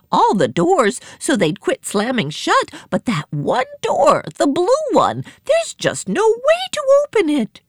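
high, flustered panic; hammed-up distress with swooping pitch; frantic report. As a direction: explain it all in a high, agitated character voice. Same frantic character keeps reporting.

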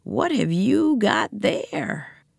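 slow raspy stranger's voice. Acted. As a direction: rasp out each word slowly. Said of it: "said a raspy voice".

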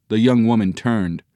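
ordinary delivery

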